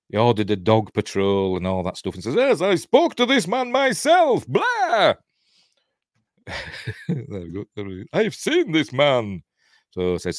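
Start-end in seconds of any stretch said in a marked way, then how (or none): 2.3-5.1 high, drawn-out mock Russian officer; hammed-up Russian accent, slow and pitched way up; comic Russian shout-out ending in a silly blah. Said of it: "putting Russian accents on, like me". 8.1-9.4 put-on Russian accent. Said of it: "putting Russian accents on, like me".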